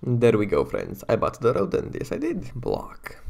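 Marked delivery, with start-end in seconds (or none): 1.1-3.2 squeaky, gleeful voice with a big jump up in pitch; gleeful put-on voice. Crowing to viewers in a silly voice about buying the horse. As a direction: crow over the new horse in a silly voice.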